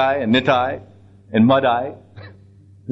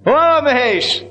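high, drawn-out call; imitated yell; 10 semitones higher, 3 dB louder, slower than usual. Nitai yells from the bullock's back, high mock yell. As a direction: call out high, acting out a wild rider. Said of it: "jumps up on the back of the bullock and yells".

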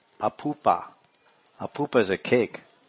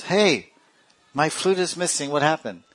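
higher-pitched mock alarm; startled Krishna; 6 semitones higher than usual. Krishna finding his flute missing, acted startled and higher. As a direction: call out on discovering a loss.

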